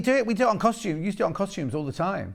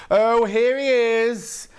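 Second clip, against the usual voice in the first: slow, high, louder drawn-out greeting; mock weary shop lady; 8 semitones higher, 7 dB louder, much slower than usual. Shop lady greets the loud regular, slow mock weary. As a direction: drag it out like a fed-up shop assistant. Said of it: "she goes".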